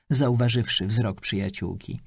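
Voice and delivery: ordinary delivery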